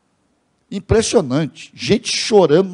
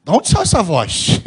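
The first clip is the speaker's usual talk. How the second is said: retold amazement; 2 semitones higher, 5 dB louder than usual. Host startled by the deep voice.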